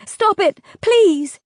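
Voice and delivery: high, loud desperate scream; screamed plea. A narrator's reading. Vega begging the ferryman to stop, screaming. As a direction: shriek it to make him stop. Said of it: "I screamed".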